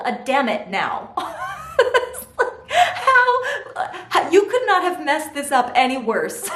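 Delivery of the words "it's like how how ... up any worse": high, laughing exasperation; laughing hard. Real, not acted. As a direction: laugh through the disbelief. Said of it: "laughing at husband".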